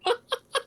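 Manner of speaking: a high short laugh; real laugh. Real, not acted. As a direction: burst out laughing. GM laughs at reply.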